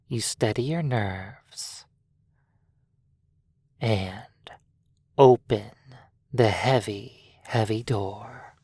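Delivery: plain reading voice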